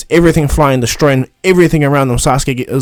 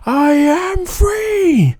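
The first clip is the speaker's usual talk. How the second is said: very high, slow, drawn-out monster shriek; demon's free cry; 14 semitones higher, much slower than usual. Retold or imitated. Sand demon bursting out, shrieking its freedom high and slow. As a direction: stretch out a gleeful demon howl of freedom. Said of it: "he screams".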